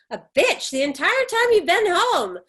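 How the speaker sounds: angry shouting mother; furious outburst. Acted. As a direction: let the anger boil over at her daughter. Mother explodes at daughter.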